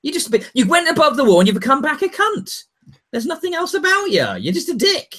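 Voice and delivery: high-pitched exasperated rant; hammed-up indignant outburst; mock telling-off. Acted telling-off of Bran.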